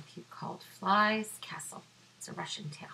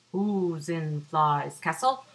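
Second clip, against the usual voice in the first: fox calling out; 2 semitones lower, 7 dB louder than usual. Acted. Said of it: "Fox calls out".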